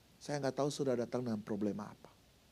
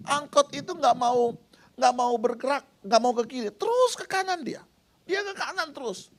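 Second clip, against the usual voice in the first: high, loud, exasperated telling; worked-up retelling; 11 semitones higher, 6 dB louder than usual. Retold or imitated. Preacher recounting the angkot that won't move, high and exasperated. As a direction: sound fed up with the stubborn driver.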